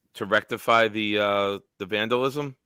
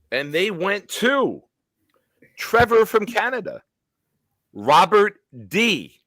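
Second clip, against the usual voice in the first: announcer hype; 7 semitones higher, 4 dB louder than usual. Acted. Host hailing new channel members, hyped announcer voice. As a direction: belt out each name like a big-show introduction.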